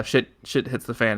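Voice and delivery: usual speaking voice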